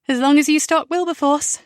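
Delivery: teasing with a smile; smiling. A narrator's reading. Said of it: "Rebecca says with a smile".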